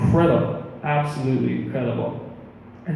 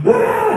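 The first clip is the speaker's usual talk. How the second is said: sudden high, louder yelp; short acted shriek of alarm; mock scream; 5 dB louder than usual. Peter starting to sink into the lake, mock scream.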